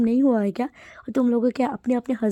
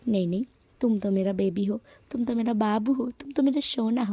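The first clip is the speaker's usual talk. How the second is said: usual speaking voice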